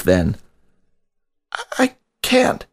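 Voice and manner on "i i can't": much higher, halting stammer forced out; a trembling plea squeezed out under a choking grip; struggling to speak. A narrator's reading. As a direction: fight to get the words out at all. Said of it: "he fought to say".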